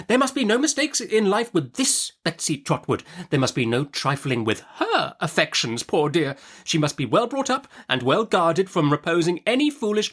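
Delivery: high, brisk stern aunt; Miss Betsy. Acted. Aunt Betsy interrogating the young mother, brisk and high. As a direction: snap the question like a stern old aunt.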